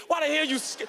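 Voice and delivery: normal talking voice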